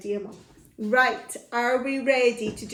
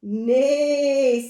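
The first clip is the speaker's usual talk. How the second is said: loud, long, raised neigh; neighing horse; 4 semitones higher, 7 dB louder than usual. Acted. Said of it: "said the horse".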